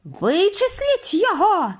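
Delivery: high, slow, menacing order; head witch's high-pitched threat; hissing. A narrator's reading. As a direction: order it with drawn-out venom. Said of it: "сичала верховна відьма".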